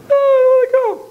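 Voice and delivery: put-on high, loud little kid voice; a boy begging for a ride; excited child. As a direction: squeal it like an eager small boy.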